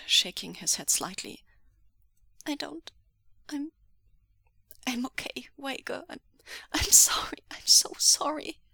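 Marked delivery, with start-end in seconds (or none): 2.5-8.6 high, halting, faltering voice; frightened, broken stammer; tearful apology. Acted. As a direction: struggle to get an apology out. Tearful victim apologizing, shivering.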